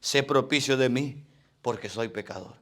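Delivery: hushed, contrite sinner's prayer; humble quiet prayer. Acted. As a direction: pray it low and small, head bowed. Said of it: "postrado, diciendo".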